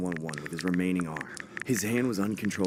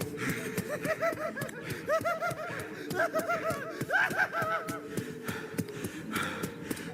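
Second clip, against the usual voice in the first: long, high maniacal clown cackle; evil cackle. Acted. Evil clown chasing him.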